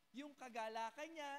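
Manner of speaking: usual speaking voice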